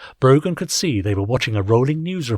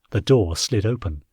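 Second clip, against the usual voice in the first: usual speaking voice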